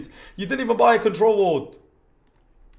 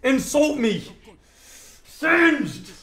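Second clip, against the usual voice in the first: loud demand; 7 semitones higher, 5 dB louder, slower than usual. Real, not acted. Demanding insults from a viewer.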